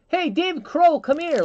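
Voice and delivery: high, loud teasing shout; mocking call. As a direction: call out to the husband by the wrong name, mockingly. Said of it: "like, mocking that".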